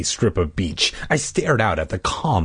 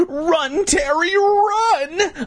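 very high, hammed-up frantic call; panicked calling; much slower than usual. Boy urges sister to flee the hands, panicked high call. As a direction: cry it out high to someone fleeing. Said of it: "I called to my sister".

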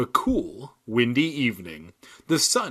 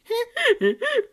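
high, hammed-up hyena cackle; a bad try at a shrieking hyena laugh; hyena giggle. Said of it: "That was my attempt at a hyena giggle".

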